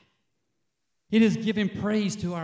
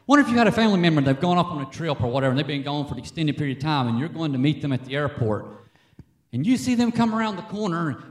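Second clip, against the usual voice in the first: usual speaking voice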